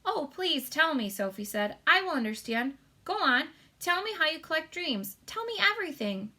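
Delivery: eager pleading. A narrator's reading. Sophie begging.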